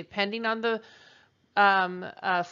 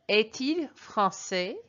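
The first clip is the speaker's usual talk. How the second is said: slow, careful French phrase for learners; slow model French; slower than usual. Shown as a demonstration. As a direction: lay it out slowly so students can copy it. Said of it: "here is how you would translate that".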